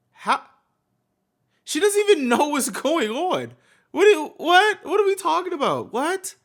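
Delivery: high, loud, baffled outburst; real incredulity. Real, not acted. Co-host reacting to wild conspiracy theories, loudly incredulous. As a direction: pitch it up in exasperated disbelief.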